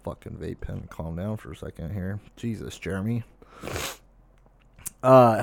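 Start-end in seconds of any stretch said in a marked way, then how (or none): 0.0-3.2 mutter while vaping. Real, not acted. Smoking a vape on stream.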